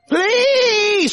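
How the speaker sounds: high, drawn-out begging wail; screaming child. Acted. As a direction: act out a kid shrieking at a parent's feet.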